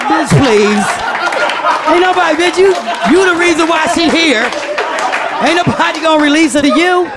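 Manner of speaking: hammed-up disbelieving cops; police scoffing. Police mocking mother.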